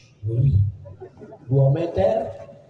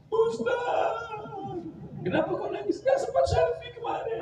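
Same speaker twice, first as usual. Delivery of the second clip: high, cheeky call to the preacher; mock mourner; 7 dB quieter than usual. Congregant at the preacher's grave, high mock voice. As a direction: tease him like a silly congregant. Mocking mourner asking why crying.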